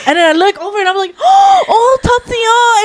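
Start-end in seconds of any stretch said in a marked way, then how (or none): 1.2-2.8 high gasping "oh" of shock; startled cry. Retold or imitated. Caught spraying the auntie, shocked cry. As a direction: blurt it out in a panic.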